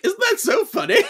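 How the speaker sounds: high-pitched giggly imitation; Bailey. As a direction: mimic someone tickled, voice leaping way up. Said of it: "Bailey was like".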